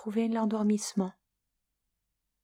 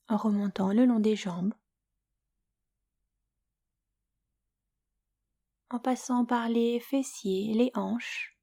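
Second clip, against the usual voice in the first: guided Yoga Nidra; 2 semitones higher than usual. Acted. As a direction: lull the listener toward sleep, unhurried and hushed at times. Leads Yoga Nidra for sleep.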